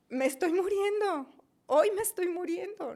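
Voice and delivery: loud, high retold outcry; desperate cry. Reliving her sense of dying, acted desperate cry.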